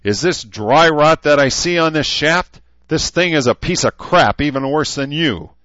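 loud, high drill-sergeant shouting; furious shout. A narrator's reading. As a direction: dress down the guards at full volume. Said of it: "he shouted".

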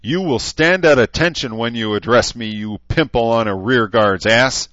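loud, high drill-sergeant shouting; furious shout. A narrator's reading. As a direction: dress down the guards at full volume. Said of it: "he shouted".

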